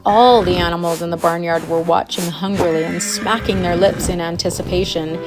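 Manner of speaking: ordinary delivery, nothing marked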